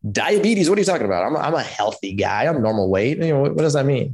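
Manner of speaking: imitating his younger self's stunned disbelief; retold disbelief. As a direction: jolt up in shock, then stay baffled. Retells shock at the diagnosis.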